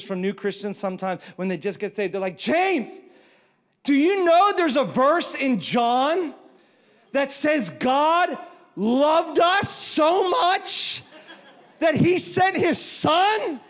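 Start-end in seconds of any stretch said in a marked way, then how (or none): 2.4-13.6 high, loud, slow thrilled text; all caps excitement. Retold or imitated. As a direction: gush it like a texted shout. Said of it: "Capital letters".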